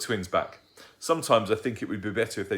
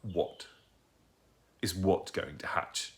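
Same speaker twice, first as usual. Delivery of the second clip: suspicious demand; 4 dB quieter, slower than usual. Acted. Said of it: "demanded Mister Tugg suspiciously".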